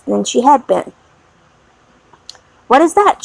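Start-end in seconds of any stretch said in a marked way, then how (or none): 2.7-3.2 sudden higher alarmed question; startled cry. A narrator's reading. Lucy hears feet on the stairs, startled cry. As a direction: jump at a sound, frightened. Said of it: "she cried all at once".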